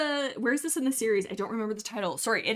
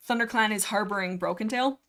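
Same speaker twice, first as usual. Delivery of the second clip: ordinary talk, nothing marked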